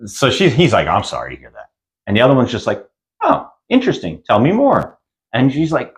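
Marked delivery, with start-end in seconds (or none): 0.9-1.7 low, soft, deadpan sympathy; flat nurse. Retold or imitated. Nurse offering Carly hollow comfort, low and soft. 3.2-5.0 higher, fake-curious; mock interest. Nurse feigning interest in Carly, mock high.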